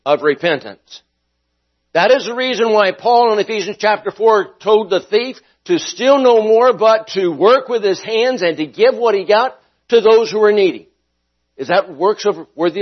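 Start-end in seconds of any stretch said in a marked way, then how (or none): 1.9-10.9 raised, rising preacher's voice; preaching. Acted. Pastor preaching Ephesians to his class, raised and rising. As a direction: press the point home to the class.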